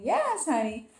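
high-pitched catchphrase imitation; Watson's catchphrase. Vlogger quoting her YouTuber friend's sign-on, lightly imitated high catchphrase. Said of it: "it's me, Waston".

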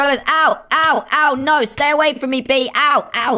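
high play-acted cries of pain; panicked yelps. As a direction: yelp as if stung, warding it off. Play-acting being stung by bee.

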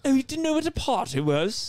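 high mock British accent. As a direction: take the British heckle up. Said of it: "Oh, much better".

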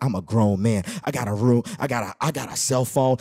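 very deep bragging man; low put-on grown-man voice. Boy boasting to girlfriend on phone, deep crooner.

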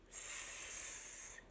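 soft snake hiss; short, quiet "sss". Acted. Imitates the snake.

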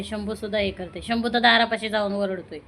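ordinary talk, nothing marked

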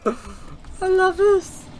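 high, excited crowing; gleeful. Real, not acted. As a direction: gloat with glee. Invincible, gleeful.

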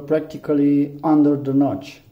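usual speaking voice

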